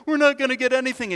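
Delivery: quick, pushed complaint; mock whining Peter. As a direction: let Peter blurt it out fast and worried. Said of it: "imagine Peter is going".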